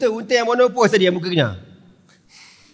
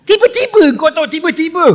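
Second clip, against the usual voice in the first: dramatic buildup; 9 semitones higher, 4 dB louder than usual. Real, not acted.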